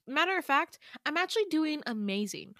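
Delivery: high-pitched, exaggeratedly upbeat sarcasm; sarcastic cheer. Crying girl brushing off Damien's concern, sarcastic cheer. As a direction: overdo how wonderful everything is.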